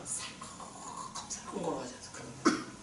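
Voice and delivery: hushed, sneaking whisper. Acted. Preacher showing the congregation how to creep past, whispered. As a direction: whisper it like you're tiptoeing. Tiptoeing through scary place.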